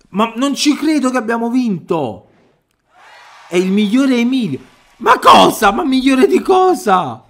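high, loud incredulous shouting; overjoyed screaming, slowing on the last question; ecstatic disbelief. Real, not acted. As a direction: yell in joyful disbelief at the win. Disbelief after winning.

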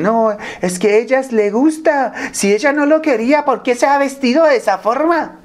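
mock a man blaming her clothes; mocking the excuse. Man justifying staring at a coworker, high mocking whine.

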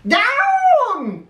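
high, drawn-out witch's holler; sudden high-pitched cry. A narrator's reading. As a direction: call it out high, falling at the end. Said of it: "cried the witch".

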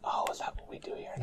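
soft whispered query; whispering. Pretend. Co-host questioning the ASMR channel gag, whispering. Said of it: "that's why we're whispering".

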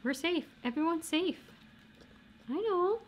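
high coaxing pet voice; high sing-song reassurance to a dog. Real, not acted. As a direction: soothe a scared puppy in a high voice. Soothing dog.

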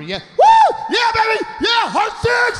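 high, whooping gambler's cheer; acted rowdy high-pitched victory yells; screaming celebration. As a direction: whoop it up like you're winning big. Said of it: "high-fiving and screaming".

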